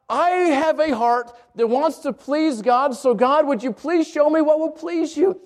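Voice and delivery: high, slow, sincere plea to God; tender, earnest prayer; heartfelt prayer. Pretend. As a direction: pray it like you mean every word.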